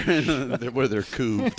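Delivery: slow, laughing through the words; cracking up at his own joke. Real, not acted. Max to friends, laughing too hard to talk. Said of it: "Max is laughing so hard".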